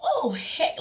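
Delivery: high-pitched mock indignation; hammed-up startled objection; exaggerated retold protest. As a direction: voice it high, overdoing the reaction. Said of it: "I didn't say it like that".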